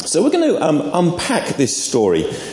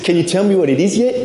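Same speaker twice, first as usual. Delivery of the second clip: attempted Australian accent, brisk and higher; amateur Australian impression, quick and lilting; 4 semitones higher, faster than usual. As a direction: have a rough go at an Australian catchphrase. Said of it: "Can't do the Australian accent".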